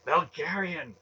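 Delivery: loud, high, sharp call. A narrator's reading. Inner voice stopping Garion's rash act, sharp loud call. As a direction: call the name out sharply to stop him. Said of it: "the voice within his mind said sharply".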